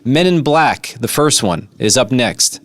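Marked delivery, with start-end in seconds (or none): none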